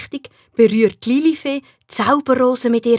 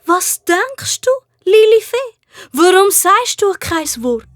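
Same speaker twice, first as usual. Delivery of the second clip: high worried queen asking; 9 semitones higher, 4 dB louder than usual. A narrator's reading. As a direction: plead with the silent fairy, uneasy. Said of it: "fragt die Schneekönigin beunruhigt".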